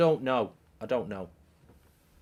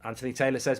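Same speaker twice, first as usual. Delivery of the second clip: normal talking voice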